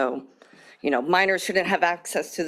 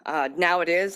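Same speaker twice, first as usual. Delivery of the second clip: usual speaking voice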